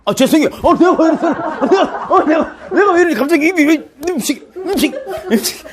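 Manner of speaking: high, loud, laughing fluster; flustered laughing apology. Pretend. A congregant caught not praying, laughing and flustered. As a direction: stumble over the words, giggling. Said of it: "네가 기도를 안 하잖아".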